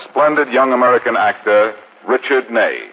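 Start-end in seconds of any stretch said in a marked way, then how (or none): none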